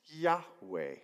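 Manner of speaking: Yahweh said yah-weh, falling; Hebrew word Yahweh. Shown as a demonstration. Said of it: "in Hebrew is pronounced".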